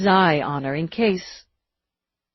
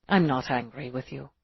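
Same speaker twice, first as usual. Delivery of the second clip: reassuring; 4 semitones lower, 6 dB quieter than usual. Acted. Roarke calming her.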